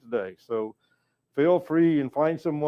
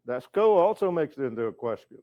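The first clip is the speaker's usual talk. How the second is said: usual speaking voice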